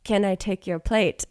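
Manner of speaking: soft and eerie, lightly voiced; creepy waiter voice.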